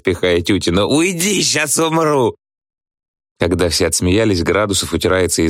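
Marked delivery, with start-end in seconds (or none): 0.9-2.4 high, drawn-out theatrical squeal. A narrator's reading. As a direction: squeal it high without extra volume. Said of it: "визжит Градусов". (высокий протяжный визг)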